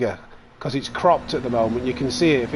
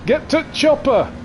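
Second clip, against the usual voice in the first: hammed-up Arnie; 12 semitones higher than usual. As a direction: bellow Get to chopper like an action hero. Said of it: "What does he say?".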